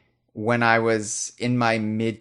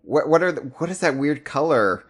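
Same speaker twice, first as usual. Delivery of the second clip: high, louder imitation of baffled questioning; mock puzzled friend; 6 semitones higher, 4 dB louder than usual. Friend asking Jeff about the strange color, mock bafflement. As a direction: play a bewildered friend, pitched up and stumbling.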